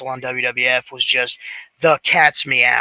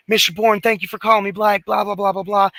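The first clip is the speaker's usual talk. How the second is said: higher, rushed imitation of a starstruck caller; gushing fanboy chatter; 6 semitones higher, faster than usual. Fan gushing at his idol on the phone, higher, breathless-fast chatter. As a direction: re-enact an excited fan filling someone's ears. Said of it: "Just really just filling his ears".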